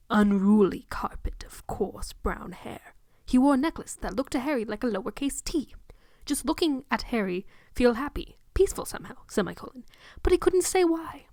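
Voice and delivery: plain reading voice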